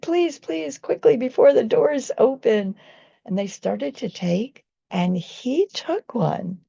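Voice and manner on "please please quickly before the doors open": urgent pleading. Retold or imitated. Urging people in the elevator.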